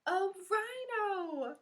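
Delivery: slow, high, drawn-out answer; a rhino, sung out slow and bright; excited reveal. Real, not acted. Kids guessing the animal.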